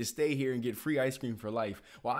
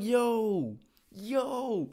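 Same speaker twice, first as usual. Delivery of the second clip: incredulous yo; 8 semitones higher, 5 dB louder than usual. Retold or imitated. Calling out in disbelief.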